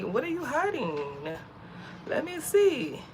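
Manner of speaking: pry with a lifted, singsong pitch; nosy prying. Retold or imitated. Nosy woman wondering aloud about private pages, prying.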